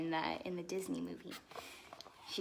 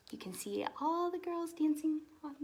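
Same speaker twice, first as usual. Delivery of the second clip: plain reading voice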